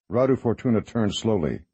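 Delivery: ordinary delivery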